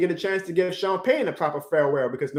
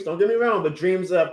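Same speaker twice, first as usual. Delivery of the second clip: ordinary talk, nothing marked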